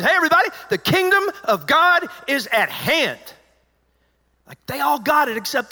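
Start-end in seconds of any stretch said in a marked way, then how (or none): 0.0-3.2 high, loud announcing call; John the Baptist proclaiming. Retold or imitated. John the Baptist calling out to everybody, loud and high. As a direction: call out to the crowd.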